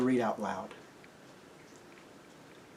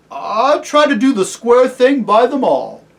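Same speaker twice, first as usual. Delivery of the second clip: high, loud working man's voice; earnest humble cabbie; 10 semitones higher, 12 dB louder than usual. Acted. Cabbie assuring Aslan he treats all fairly, earnest. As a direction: protest it with honest earnestness.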